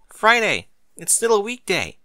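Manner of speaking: high, loud alarmed exclamation; startled realization. Acted. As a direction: jolt awake in a panic. Mary realizes she overslept.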